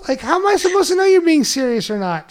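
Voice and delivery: high-pitched, flustered pleading; mock defensive protest. Husband blaming wife's texting, mock defensive protest. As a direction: plead your case, pitch way up.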